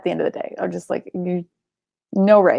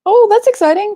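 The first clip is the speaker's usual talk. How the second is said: excited; 13 semitones higher, 6 dB louder than usual. Real, not acted. Reacting to chat news.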